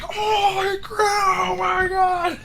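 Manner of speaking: high, slow cry of joy; overwhelmed, almost sobbing; near-crying shout. Retold or imitated. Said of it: "I'm just like".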